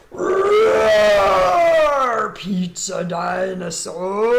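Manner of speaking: a loud playful dinosaur roar; mock roar. Host roars for the pizza dinosaur, loud and playful.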